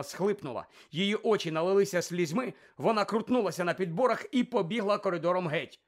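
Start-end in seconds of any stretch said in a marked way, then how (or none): none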